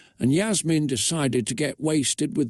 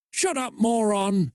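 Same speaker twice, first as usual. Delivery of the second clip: lightly snapped, higher-pitched retort; snappish; 7 semitones higher than usual. A narrator's reading. Twin sister shutting up her complaining sibling, mildly snappish. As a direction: cut her off with a mild, high snap. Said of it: "snapped her twin sister Kirsty".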